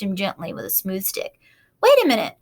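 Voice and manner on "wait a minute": high, loud excited shout; excited cry. A narrator's reading. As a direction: call out excitedly. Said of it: "cried Avery".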